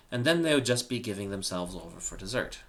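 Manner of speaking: usual speaking voice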